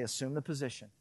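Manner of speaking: hushed commanding God; God's stern quiet command. Retold or imitated. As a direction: say it as a calm divine father. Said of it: "God's gonna say".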